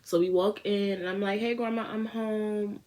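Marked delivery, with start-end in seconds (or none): 1.4-2.8 slow, high sing-song; innocent act. Retold or imitated. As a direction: act totally sober. Faking innocence to grandma.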